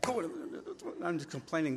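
ordinary talk

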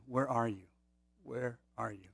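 soft, sorrowful calling out; pleading call. Retold or imitated. God gently calling hiding Adam, soft pleading. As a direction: coax him out of hiding with sorrow, not anger. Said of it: "I think it was a pleading voice".

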